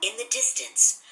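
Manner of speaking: plain reading voice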